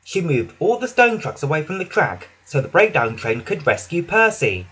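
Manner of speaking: ordinary delivery, nothing marked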